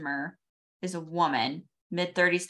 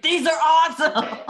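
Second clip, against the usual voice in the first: excited; 11 semitones higher, 8 dB louder than usual. Retold or imitated. Imitating adult admiring tools.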